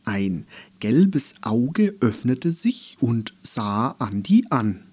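plain reading voice

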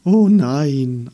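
loud, drawn-out wail; theatrical dismay; dismayed chorus. A narrator's reading. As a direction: answer as a lamenting chorus. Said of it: "antworteten die anderen".

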